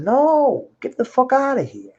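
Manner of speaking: high, sharp yell of refusal; high mock shout telling dealers to leave; retold yell. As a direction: shout them away as told. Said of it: "I was yelling".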